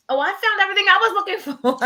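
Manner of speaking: high, quick sarcastic chirp with a laugh; fake-sweet comeback, laughing; sarcastic reply. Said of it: "I wanted to say".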